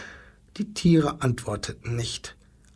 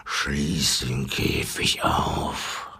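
much lower, slow whispered command; low lion whisper; 13 semitones lower, much slower than usual. Acted. Lion tells the zookeeper to open the cage, low slow whisper.

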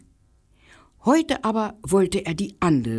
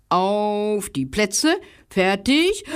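slow race start; 4 semitones higher, slower than usual. Acted.

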